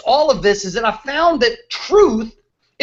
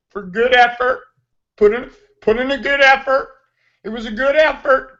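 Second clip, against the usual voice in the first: lightly voiced bland athlete, playing it safe; hint of a scripted, classy sound bite; mock polite post-game interview. Mocking athletes who give polite post-game interviews, lightly hammed. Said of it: "The only people who talk like this".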